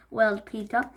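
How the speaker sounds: plain reading voice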